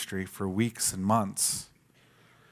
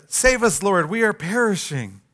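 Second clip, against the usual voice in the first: panicked plea; 6 semitones higher, 3 dB louder than usual. Retold or imitated. Disciples panicking in the storm.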